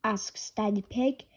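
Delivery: plain reading voice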